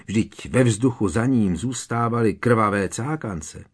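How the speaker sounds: usual speaking voice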